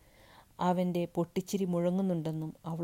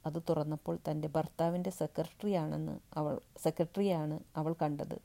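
ordinary delivery, nothing marked

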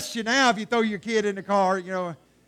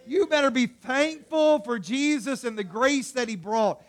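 usual speaking voice

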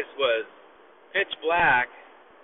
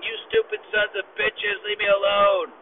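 raised, high furious yell; retold yell; 8 semitones higher, 4 dB louder than usual. Les yells at unseen kids outside, furious. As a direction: scream it out at the prowlers. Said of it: "just screaming and yelling".

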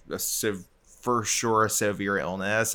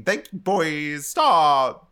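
high, slow flirty influencer voice; James Charles impression; 5 semitones higher, 7 dB louder, much slower than usual. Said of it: "my James Charles impression".

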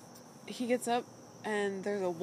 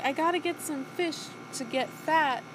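Callie voice; 5 semitones higher than usual. Retold or imitated. Voicing Callie.

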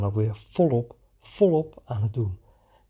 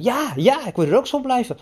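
much higher, delighted agreement; high happy echo of his joy; cheerful play voice; 12 semitones higher, 4 dB louder than usual. Retold or imitated. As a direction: beam along with the child. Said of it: "ik zei van".

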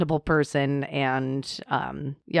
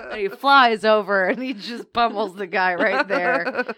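usual speaking voice

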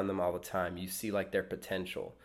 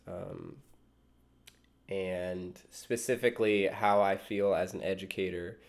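ordinary talk, nothing marked

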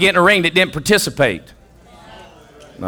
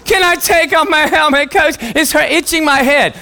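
put-on high kid's begging voice; high childish plea; pleading kid; 10 semitones higher than usual. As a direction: beg the coach like a small boy. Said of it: "kid begging coach".

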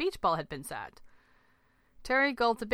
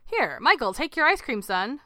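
raised, high urging; insistent; 5 semitones higher, 6 dB louder than usual. A narrator's reading. Dad thrusting ice cream at his son, insistent. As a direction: press it on them loudly.

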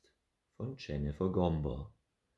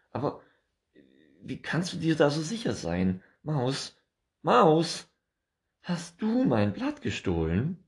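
high, loud, suspicious squirrel voice; accusing squirrel; 11 semitones higher, 10 dB louder than usual. Acted. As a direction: pin the mouse down with it.

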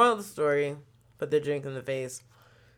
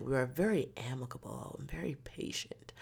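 soft unvoiced whisper; 9 dB quieter than usual. Real, not acted. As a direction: whisper it calmly. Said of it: "even the tone".

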